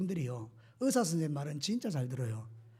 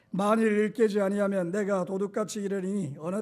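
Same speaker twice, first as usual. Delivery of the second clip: unison scripture reading. Shown as a demonstration. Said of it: "거기부터 한번 읽겠습니다".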